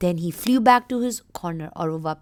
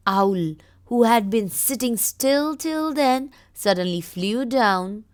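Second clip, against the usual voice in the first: slow, louder suspense read; dramatic story reading; 5 dB louder, slower than usual. Acted. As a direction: build the moment for the kids.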